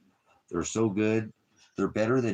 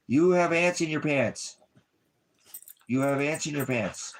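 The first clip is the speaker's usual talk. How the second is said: high, louder teasing singsong to the dog; playful teasing; 9 semitones higher, 5 dB louder than usual. Real, not acted. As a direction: tease the wriggly puppy.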